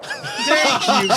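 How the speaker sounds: high squealed thanks through laughter; mock squeal. Mock squeal after a player's move.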